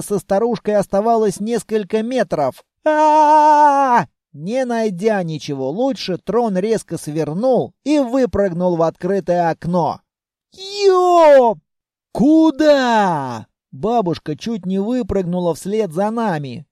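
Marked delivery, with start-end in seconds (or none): 2.9-4.0 long, raised "aaah"; alarmed scream. Acted. Rider about to hit grandmother, alarmed scream. 10.5-11.6 very high, loud yelp; startled yelp. Acted. Rider flung out of an open window, startled yelp. 12.1-13.5 loud, high, drawn-out call; outraged shout. Acted. Grandmother at the window as they escape, outraged shout. Grandmother yells after them.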